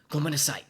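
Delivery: "gomenasai" said loud and clear, falling; polite "gomenasai" presented clearly. Shown as a demonstration. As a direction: announce "gomenasai" crisply. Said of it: "and that is".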